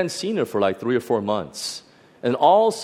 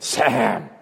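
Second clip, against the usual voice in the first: a light rasp, like a demon calling; raspy demonic voice; 2 semitones lower than usual. Retold or imitated. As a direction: growl the name out, lightly possessed. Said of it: "in this raspy, demonic voice said".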